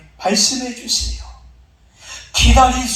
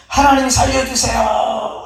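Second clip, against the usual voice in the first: raised, drawn-out plea to heaven; prayer cry; 4 semitones higher than usual. Acted. Man ignoring the log prays for rescue, calling out. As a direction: call on God, dragging out the final syllable. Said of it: "하고".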